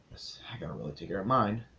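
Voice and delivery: hushed joke to the viewers; whispered aside. Real, not acted. Vlogger whispering a joke aside about his own hair. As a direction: whisper it as a quick private aside.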